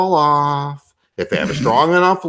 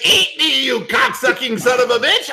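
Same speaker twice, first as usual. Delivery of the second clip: higher, hammed-up shock-jock insult; mock on-air insult; 6 semitones higher than usual. Shown as a demonstration. Host shows his on-air persona with a hammed-up insult. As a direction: bark it like the on-air character. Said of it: "I would not say that".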